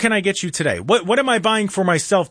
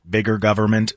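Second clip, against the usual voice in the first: lower, flat robot voice droning the phrase; robotic monotone; 8 semitones lower than usual. Pretend. Host mimics a robot repeating what he hears. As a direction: drone it like a machine. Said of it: "in this monotone, robotic sounding voice".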